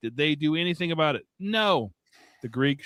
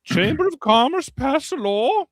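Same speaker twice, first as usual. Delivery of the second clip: put-on Irish accent; 7 semitones higher, 4 dB louder than usual. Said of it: "it's just Irish".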